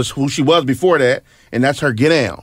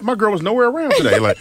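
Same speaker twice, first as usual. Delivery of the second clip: laughing; 7 semitones higher than usual. Real, not acted. Both laughing.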